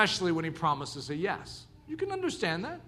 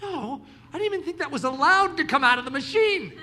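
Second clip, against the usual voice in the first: higher, laughing disbelief; stunned disbelief; 7 semitones higher, 4 dB louder than usual. Retold or imitated. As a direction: laugh in shock at the no. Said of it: "And it's like".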